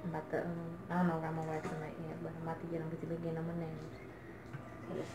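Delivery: hushed so as not to wake him; quiet at night. Real, not acted. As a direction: keep it low, someone's asleep. Whispering so Danny stays asleep.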